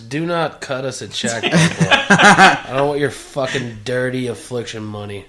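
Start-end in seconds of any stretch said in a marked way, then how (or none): 1.4-5.2 mock outrage. Mock refusal of a check.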